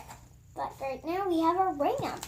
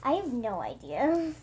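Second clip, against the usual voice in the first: usual speaking voice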